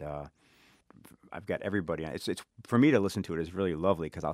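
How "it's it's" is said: ordinary talk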